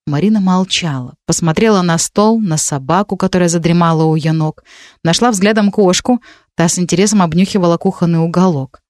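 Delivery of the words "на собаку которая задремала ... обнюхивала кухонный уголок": usual speaking voice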